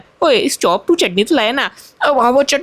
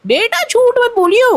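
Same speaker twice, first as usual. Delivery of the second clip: warning aunty voice; 13 semitones higher, slower than usual. Acted. Aunty warns the boy.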